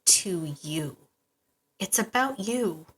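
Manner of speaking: soft whispered ending to the listener. Real, not acted. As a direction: breathe the last words to them. Tender closing point, drops to whisper.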